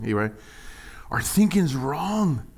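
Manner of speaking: usual speaking voice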